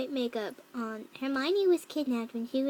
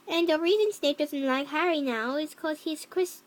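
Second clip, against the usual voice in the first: ordinary delivery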